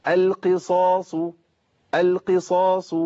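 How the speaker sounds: القصاص slow, full heavy s with long aa; heavy saad. Shown as a demonstration. Teacher models tafkhim, slow heavy saad in القصاص. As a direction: stretch القصاص with an emphatic saad. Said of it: "تفخيم عالي لأنها في أعلى مراتبها".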